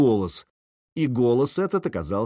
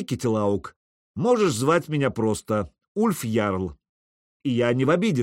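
plain reading voice

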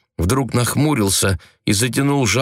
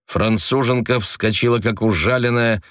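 usual speaking voice

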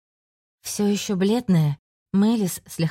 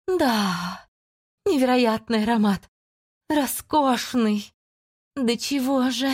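ecstatic demon voice; 6 semitones higher, slower than usual. Acted. Demon relishes the scent.

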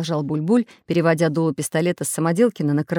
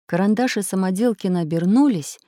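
ordinary delivery

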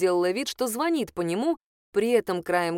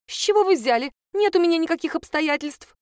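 high, louder flustered denial; the accountant recoiling from the suggestion, high and louder; alarmed protest; 9 semitones higher, 4 dB louder than usual. A narrator's reading. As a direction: push the idea away in alarm.

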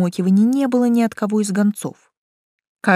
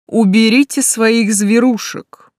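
louder, slow irritated order; charm lost; 3 semitones higher, 5 dB louder, slower than usual. A narrator's reading. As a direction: order them away as an exasperated count. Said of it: "окончательно растерял обаяние Байлард". (раздражённый приказ, громко и с расстановкой)

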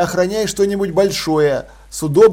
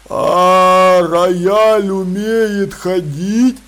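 slow troll voice; 5 dB louder, much slower than usual. Acted. Troll Detritus questions the piano.

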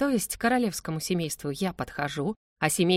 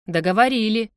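louder, decisive rebuttal; firm contradiction; 3 semitones higher, 7 dB louder than usual. A narrator's reading. Son tells father the talk is over, firmly. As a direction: contradict him loudly, closing the subject. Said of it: "не согласился Шарль".

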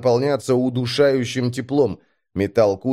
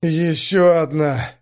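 slow, hoarse, higher-pitched response; hoarse reply; 4 semitones higher, slower than usual. A narrator's reading. Driver spotting the next wall of fog, hoarse. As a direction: rasp it out slowly. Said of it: "сипло отозвался".

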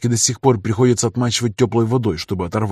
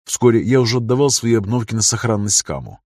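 ordinary delivery

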